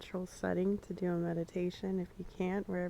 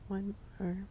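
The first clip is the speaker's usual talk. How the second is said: calm, unhurried guiding voice with long pauses; guided meditation. Acted. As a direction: ease listeners into the grass image. Leads a shared guided meditation.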